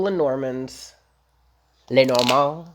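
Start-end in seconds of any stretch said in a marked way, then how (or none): 1.9-2.7 louder put-on French accent. Shown as a demonstration. Tarot reader trying out the card deck's name, French accent. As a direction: say Lenormand with a French lilt, rising. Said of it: "Like, with a French accent?".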